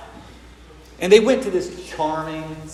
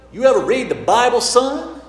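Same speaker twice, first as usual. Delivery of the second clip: loud, higher-pitched Southern local, rising question; Southern accent; 8 semitones higher, 6 dB louder than usual. Acted. Local quizzes the visiting father, emphatic Southern accent. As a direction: challenge them in a put-on Southern US voice. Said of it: "lovely southern voice".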